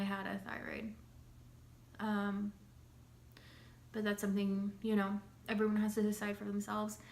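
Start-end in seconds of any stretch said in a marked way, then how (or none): none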